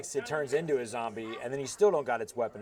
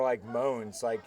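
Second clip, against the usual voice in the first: ordinary delivery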